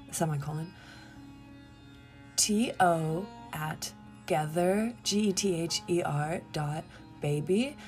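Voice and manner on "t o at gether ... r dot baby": careful dictation. Real, not acted. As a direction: dictate it letter by letter. Dictates email address to listeners.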